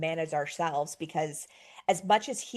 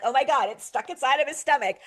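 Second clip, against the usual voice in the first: frantic; 5 semitones higher, 6 dB louder than usual. Retold or imitated. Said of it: "I am, like, apoplectic".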